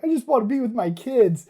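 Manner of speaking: high, loud retold wail; mock plaintive plea. Dad wanting time with crying kids, pleading. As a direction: plead it, pitched well up.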